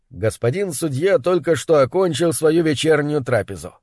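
ordinary delivery, nothing marked

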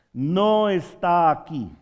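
loud, slow angelic announcement; slow, forceful preacher's quote; proclamation. Retold or imitated. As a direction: proclaim it to the congregation, stretched out.

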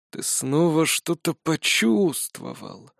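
put-on slow, soft old man's observation; a frail old wizard stating a fact. A narrator's reading. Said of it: "констатировал он".

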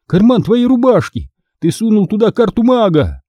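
high, pressing narrated shout; urgent cry. A narrator's reading. Boy telling friend where the card is, crying out. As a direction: urge him with pitch right up. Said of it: "крикнул я".

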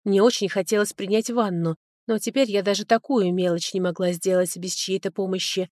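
usual speaking voice

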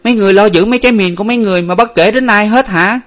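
high, loud, rapid outburst; enraged young woman yelling; furious. A narrator's reading. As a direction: lash out angrily at the sentry. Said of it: "Nguyệt giận điên lên".

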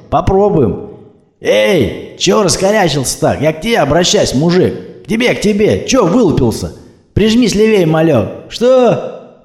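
swaggering tough guy barking from his jeep; cocky thug. Acted. Two thugs in a jeep.

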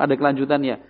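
normal talking voice

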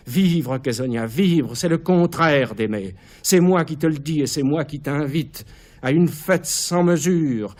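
high, loud, ecstatic build; manic exaltation. Acted. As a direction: soar with mad joy. Caligula exalte sa fête sanglante. (exaltation démente, voix haute)

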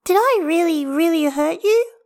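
put-on high, loud childlike worry; concerned little girl. A narrator's reading. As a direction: ask anxiously in a child's voice. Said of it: "Hestia suddenly looks concerned".

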